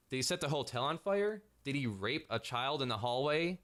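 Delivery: ordinary talk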